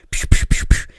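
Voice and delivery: loud, unvoiced pew noises; imitated gunfire pews. Reteller imitating her shooting out the window, loud pew noises. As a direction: spit out rapid toy-gun shots.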